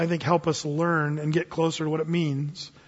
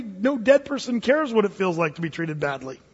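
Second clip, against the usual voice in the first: normal talking voice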